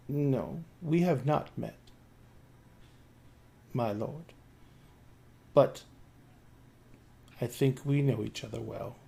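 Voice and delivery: a hint of an icy possessed girl, soft; understated cold reply. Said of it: "In that same cold voice".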